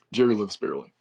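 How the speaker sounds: usual speaking voice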